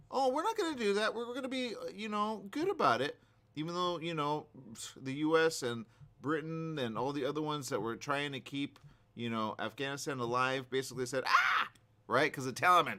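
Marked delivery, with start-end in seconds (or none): 0.1-3.2 mocking quote. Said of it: "which they said". 11.3-11.7 short shrieking mock yelp, far higher; a sudden squeal of panic, mocking. Mocking the allies' flight.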